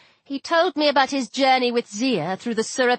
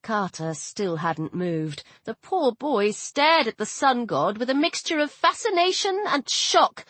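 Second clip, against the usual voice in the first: ordinary delivery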